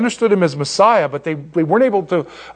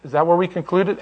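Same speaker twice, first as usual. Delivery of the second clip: normal talking voice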